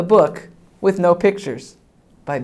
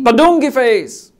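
high, loud silly shout; 6 semitones higher, 8 dB louder than usual. Acted. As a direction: belt it out. Silly sounds climax.

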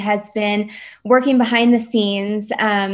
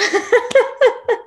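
high emotional laugh; 12 semitones higher than usual. Real, not acted. Chair overwhelmed on stream.

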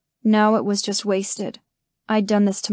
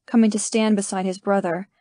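ordinary delivery, nothing marked

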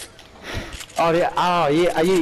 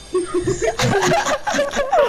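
high laugh. Real, not acted. Gamer cracking up at his own joke, high laughter. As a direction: burst of high cackling laughter.

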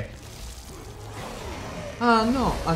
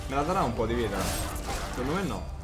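ordinary delivery

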